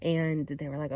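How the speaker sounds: usual speaking voice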